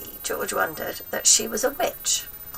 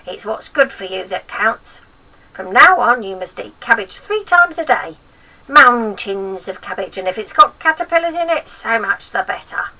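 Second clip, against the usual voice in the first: stern grandma voice; 3 semitones higher, 9 dB louder than usual. A narrator's reading. Grandma lecturing George harshly.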